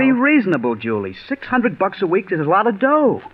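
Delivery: pleading. Acted. Husband pleading with his wife over the job, excited. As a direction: win her over with excitement.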